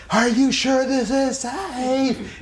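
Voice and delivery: high, slow frightened shout; screamed worry. Retold or imitated. Teacher on the ledge, high slow scared yell. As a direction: stretch out a scared high cry. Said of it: "he screams".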